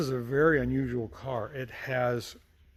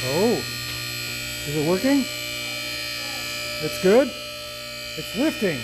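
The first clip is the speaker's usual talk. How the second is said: excited; 7 semitones higher, 6 dB louder than usual. Real, not acted. Lift test succeeding, tense excitement.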